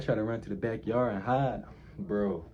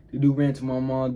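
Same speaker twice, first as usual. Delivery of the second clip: louder, punched restatement; emphatic retelling; 4 semitones higher, 6 dB louder than usual. Retold or imitated. Retelling to viewers how the friend snitched, louder and emphatic. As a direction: hit it harder the second time, outraged.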